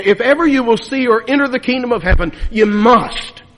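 loud, driving pulpit delivery; full-force preaching. Real, not acted. Preacher to his congregation, urging them, in a loud, driving voice. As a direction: hammer the point home to the congregation.

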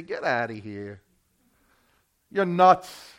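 low, dismissive scoff; scoffing dismissal. Acted. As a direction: brush her off as crazy. Disciples scoffing at Mary.

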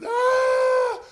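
high, loud cry of agony; injured runner's scream of agony; scream of pain. Retold or imitated. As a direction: howl like a torn hamstring. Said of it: "He's just screaming".

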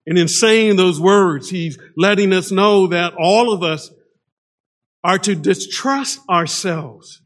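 ordinary talk

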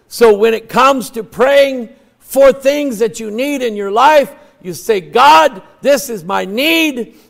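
emphatic sermon, ringing out; preaching at full force. Real, not acted. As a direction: drive each phrase home to the congregation.

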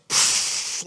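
unvoiced pfft of air brakes; air brake hiss. A preacher mimicking the bus arriving, unvoiced hiss. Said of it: "like that".

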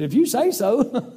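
dry, doubting reply, slowly through a laugh; wry laugh. Retold or imitated. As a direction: humour him with a skeptical chuckle. Wife's dry reply to his excitement.

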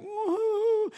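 high put-on woo-hoo boo-hoo of a crying mother; mock weeping wail; mother's mock sob. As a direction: ham up mom's sob. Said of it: "Mom, she starts crying".

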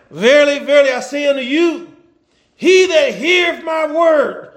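Verily verily shouted as a challenge; confrontational reading. Shown as a demonstration. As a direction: preach it like calling out the doubters. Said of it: "read it with that confrontation".

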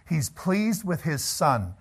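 ordinary delivery

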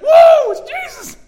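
high-pitched, very loud excited whoop; mock unborn baby bursting with excitement. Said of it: "He was so excited".

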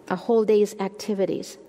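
normal talking voice

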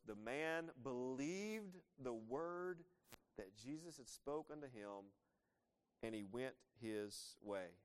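slow, deliberate reading with weight on believed; emphasized phrase. Shown as a demonstration. Preacher pointing the congregation to one phrase, slow emphasis. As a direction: savour each word of the verse. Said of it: "Now look at this phrase".

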